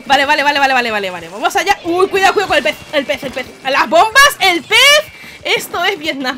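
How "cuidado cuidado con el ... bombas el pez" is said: ordinary talk, nothing marked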